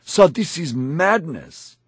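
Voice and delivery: high, drawn-out protest; shocked shout. A narrator's reading. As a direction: cry out slowly in disbelief. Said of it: "I shouted when I saw".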